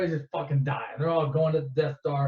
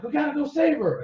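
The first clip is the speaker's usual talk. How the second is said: higher-pitched mock urging; urgent plea; 9 semitones higher than usual. Luke urging Han to save Leia, urgent. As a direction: plead in a raised, insistent voice.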